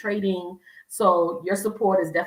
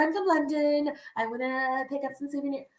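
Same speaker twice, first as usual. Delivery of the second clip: London tourist; 6 semitones higher, 5 dB quieter than usual. Retold or imitated.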